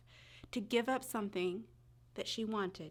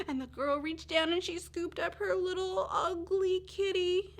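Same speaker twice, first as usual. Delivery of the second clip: joyful gasp; 10 semitones higher, 6 dB louder than usual. A narrator's reading. Joyful tearful reunion with kitten.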